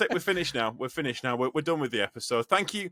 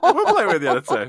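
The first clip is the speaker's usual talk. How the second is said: louder, laughing repeat; 4 semitones higher, 9 dB louder than usual. Shown as a demonstration. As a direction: laugh it out loud. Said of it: "repeats it loud, laughing".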